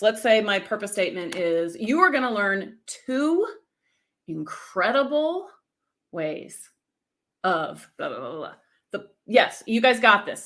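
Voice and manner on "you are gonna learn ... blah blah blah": slow, with pregnant pauses between words; pausing for effect. Shown as a demonstration. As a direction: hang on each word before the next. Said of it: "you could do something like this".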